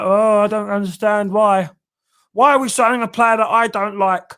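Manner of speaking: high, loud, nasal-free mock whine of a moaning fan; whiny fan voice. Host mocking a complaining fan on Twitter, high mock whine. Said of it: "that's how I imagine them talking".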